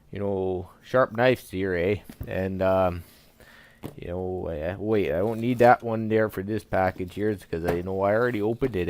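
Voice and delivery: hammed-up Canadian accent; thick comic Canadian accent. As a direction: play it as a folksy Canadian with a sing-song "eh".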